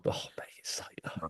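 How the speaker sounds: whispered, fading right down. Real, not acted. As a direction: let the words drop into a whisper. Trails off about rugby.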